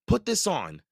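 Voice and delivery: put-on boy handing sister the eyepiece; insistent boy. A narrator's reading. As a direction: press it on her. Brother insists.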